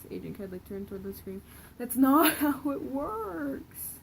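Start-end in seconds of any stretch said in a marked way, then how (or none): 1.8-3.9 cracking up, high and loud; laughing too hard to read straight. Real, not acted. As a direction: burst into real laughter mid-sentence. Reader cracks up mid-line.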